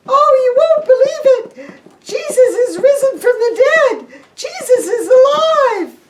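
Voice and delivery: high, loud, laughing excitement; excited women. Acted. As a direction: burst in with joyful news. Women excitedly telling good news.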